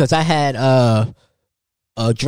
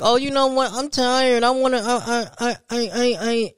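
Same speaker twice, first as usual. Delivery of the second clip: put-on high, whiny stammering child; a kid stalling at bedtime; 8 semitones higher than usual. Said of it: "then they wanna sit there and be like".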